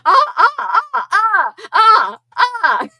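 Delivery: high, loud laughing cries of "ah"; giddy high-pitched shrieks of laughter. Real, not acted. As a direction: let out loud squealing laughs. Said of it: "I'm gonna stop saying "ah".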